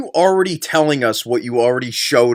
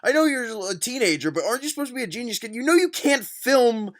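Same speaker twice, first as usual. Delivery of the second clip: exasperated rant; 6 semitones higher than usual. Real, not acted. Yelling at character on screen.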